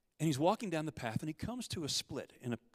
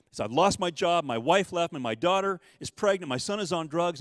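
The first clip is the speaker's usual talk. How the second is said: loud, higher outpouring of bad news; yelling out his troubles; 5 semitones higher, 9 dB louder than usual. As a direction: blurt it all out, loud and raw. He's yelling all this.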